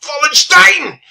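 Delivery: high, loud German-style Wallenstein; German pronunciation. Shown as a demonstration. Repeats name in put-on voice.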